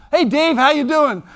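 high, loud cheerful hello; hearty greeting. Retold or imitated. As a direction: greet him warmly and loudly. Friend greets with the wrong name.

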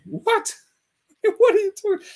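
high, loud laughing what; mock outrage at the claim; laughing disbelief. Real, not acted. Reacting to the bomb quote, laughing in disbelief.

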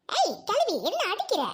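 high, swooping outraged squawk; indignant quarrel. Acted. Quarrelling girl to old woman who hit her, indignant. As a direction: protest sharply at being hit.

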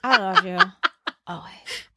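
soft mumble through unmoving lips, dying away; stiff-lipped ventriloquist mumble. Retold or imitated. Repeating the movie hero's line for laughs, ventriloquist mumble. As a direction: mimic a ventriloquist's barely-there delivery. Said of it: "Like he's a ventriloquist".

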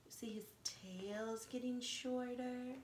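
hushed storyteller whisper, slow; soft slow whisper of a wonder. Real, not acted. Storyteller hushes to show a detail.

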